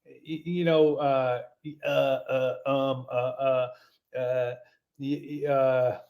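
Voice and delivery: mocking imitation full of uhs and ums; stall, restart and grope for the excuse; stammering, evasive bad-news caller; halting talker. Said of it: "this is how this guy talks".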